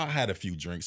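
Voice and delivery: ordinary talk, nothing marked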